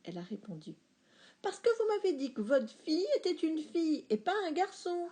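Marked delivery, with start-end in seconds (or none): 1.4-5.1 high, exasperated reply; fed-up waitress. A narrator's reading. Waitress fed up with dad, high and exasperated. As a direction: lose patience politely. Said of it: "commençait à en avoir marre".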